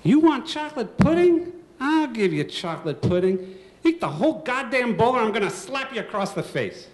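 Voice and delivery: high, loud furious mom threat; angry mother. Retold or imitated. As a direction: threaten him like a fed-up mother.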